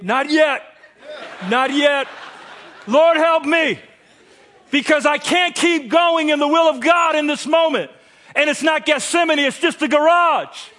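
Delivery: shouted prayer, laughing at himself; loud desperate prayer. Retold or imitated. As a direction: fight the anger out loud to God. Praying angrily in the garage.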